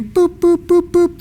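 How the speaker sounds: high, quick imitated scanner beeps; mock scanner beeps. Cashier scanning my groceries, mimicking the scanner beeps. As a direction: beep like a checkout scanner.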